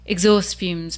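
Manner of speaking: ordinary talk, nothing marked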